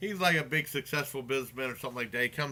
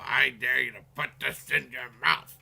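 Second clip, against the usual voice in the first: hammed-up madman's dare. Acting out madman's dare in retelling.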